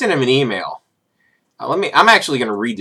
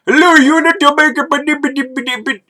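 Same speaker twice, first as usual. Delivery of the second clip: put-on high, slow Indian-accented recruiter voice; hammed-up Indian accent; mock Indian accent; 5 dB louder, slower than usual. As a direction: imitate an Indian recruiter on the phone, pitched well up. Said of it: "from Indian recruiters".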